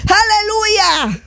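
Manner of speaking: high, sustained pulpit proclamation; impassioned preaching. Acted. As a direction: preach it high with ringing fervour. Preaches at full force.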